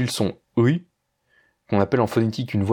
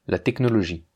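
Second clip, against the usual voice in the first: normal talking voice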